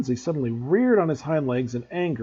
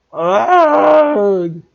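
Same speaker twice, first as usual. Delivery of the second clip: high, loud horrified shriek; terrible scream; 8 semitones higher, 12 dB louder than usual. Acted. Malfoy sees the figure drink blood, screaming. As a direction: shriek in sudden horror. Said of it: "Malfoy let out a terrible scream".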